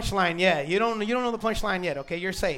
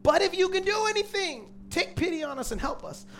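pleading father; 10 semitones higher than usual. Retold or imitated. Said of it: "father's plea, quoted".

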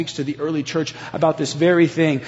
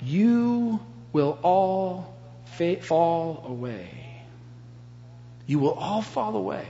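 slow, soft and solemn; quiet grave warning; 8 dB quieter, slower than usual. Retold or imitated. Jesus quietly warns his disciples, slow and soft. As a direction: let each word drop quietly, like a sad verdict. Jesus paused before he spoke them.

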